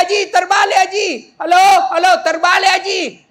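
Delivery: high, loud comic calling; mock shouted exchange; shouted correction. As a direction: imitate frantic hollering over a phone mix-up. Acted shouting over upside-down phone.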